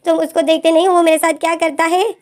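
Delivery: as if nobody believes him, push it up high; exasperated complaint. Acted. Win complains about his pursuer.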